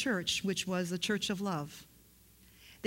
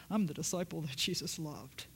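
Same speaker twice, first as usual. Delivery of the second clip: lower, softer self-important claim; mock prideful; 4 semitones lower, 5 dB quieter than usual. Shown as a demonstration. Preacher showing how John didn't say it, mock prideful. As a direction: say it as if proud of yourself. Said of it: "it wasn't because of pride. He didn't say it".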